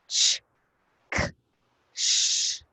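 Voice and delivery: "Ch, k, sh" as three separate voiceless sounds; phonics sounds. Shown as a demonstration. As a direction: sound out "Ch", "k", "sh" one by one, pausing between. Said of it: "Three sounds".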